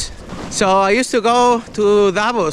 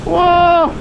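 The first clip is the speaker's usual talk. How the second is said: excited yelling; 8 semitones higher, slower than usual. Real, not acted. Speeding downhill, expecting crash.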